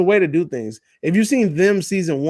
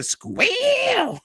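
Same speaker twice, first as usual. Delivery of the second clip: imitated squeal; 3 dB quieter than usual. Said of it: "the fucking Deliverance whistle".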